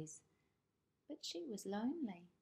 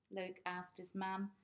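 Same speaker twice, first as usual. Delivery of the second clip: ordinary delivery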